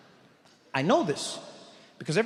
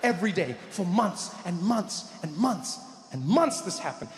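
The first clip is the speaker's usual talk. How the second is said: ordinary delivery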